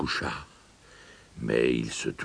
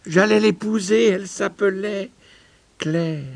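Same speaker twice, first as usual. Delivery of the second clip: high-pitched, emotional exclamation; impassioned cry; 8 dB louder than usual. A narrator's reading. Old miser sees his lost fiancée, crying out emotionally. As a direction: cry it out, softening on the final name. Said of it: "s'écria Paul".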